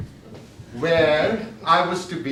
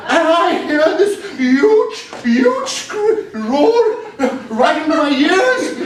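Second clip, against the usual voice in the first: higher and louder, half laughing; excited alarm; 9 semitones higher, 6 dB louder, slower than usual. Acted. Speaker relives the tiger's roar, high excited telling. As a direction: tell it like the roar is still ringing. Tiger roars in his ears.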